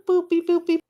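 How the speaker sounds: high sing-song boop beep sounds; playful beeps. Pretend. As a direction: chirp them out like a machine. Sound effects while measuring.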